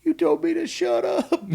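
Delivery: high, slow, tearful wail; mock-bawl like a hurt little kid; exaggerated crying-kid whine; imitated bawling child. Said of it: "he just starts bawling".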